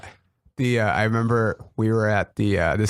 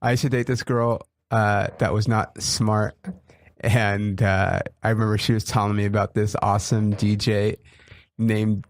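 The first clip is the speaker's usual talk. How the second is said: ordinary talk, nothing marked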